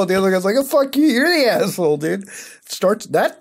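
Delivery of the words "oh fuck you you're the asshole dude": mock angry retort. Acted phone quarrel.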